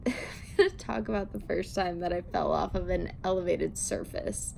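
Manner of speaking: normal talking voice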